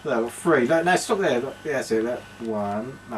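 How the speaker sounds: ordinary talk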